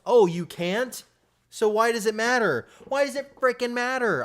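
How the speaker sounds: high, loud sarcastic taunt; mock them with a shrill sneer. Continues mocking the bragging player.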